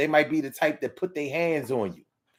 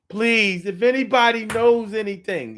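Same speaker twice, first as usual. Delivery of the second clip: higher, louder put-on plea; badly faked crying; 7 semitones higher, 5 dB louder than usual. Replaying the guilty relative's news clip, fake crying plea. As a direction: plead as if tearful, unconvincingly. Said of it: "they don't be getting the cry off".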